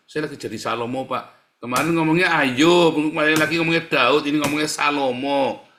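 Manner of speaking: loud mock jeer from the neighbour. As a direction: tease him loudly for his pious names. Preacher voices mocking neighbour.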